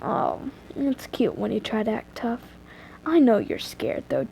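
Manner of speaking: hushed, mildly creepy taunt; teasing stalker. Acted. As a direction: tease quietly, close and low. Stranger teases the frightened heroine.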